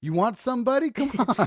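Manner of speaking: loud, high, taunting; tough guy challenge. Retold or imitated. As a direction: square up and taunt. Aussie dudes squaring up to kangaroo.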